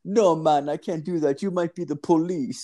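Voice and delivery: a light Jamaican lilt, pitched well up; Jamaican accent. Retold or imitated. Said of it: "It's, like, a Jamaican dude".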